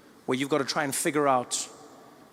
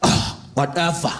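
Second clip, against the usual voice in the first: slow, hammed-up dismissive whatever; dad overdoing the brush-off; exaggerated eye-roll whatever; overdone whatever; 4 semitones lower, slower than usual. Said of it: "that's too much".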